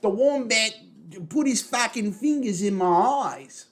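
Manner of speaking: high, loud mock Australian; Kano. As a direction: wail it in a hammed-up Aussie accent. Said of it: "they make Kano really fucking Australian".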